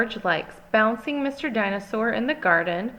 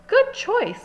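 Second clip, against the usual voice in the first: high chirpy shopkeeper; 4 dB louder than usual. A narrator's reading. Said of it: "says Mister Fox".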